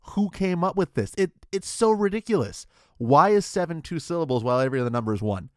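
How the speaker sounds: high, loud mock-exasperated rant; hammed-up fury over a silly thing; mock outrage. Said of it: "it is infuriating".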